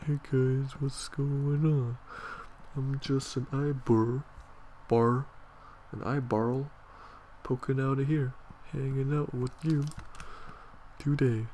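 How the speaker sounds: chirpy little eyeball saying hi; eyeball character voice. Pretend. The drawn eyeball speaks itself.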